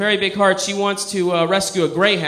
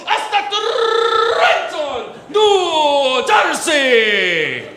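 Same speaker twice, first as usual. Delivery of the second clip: very high, loud, slowed Spanish TV shout; excited Spanish weatherman; 11 semitones higher, 4 dB louder, much slower than usual. Retold or imitated. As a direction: belt the forecast like a hyped Spanish weatherman. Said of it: "the guy goes over to the weather map".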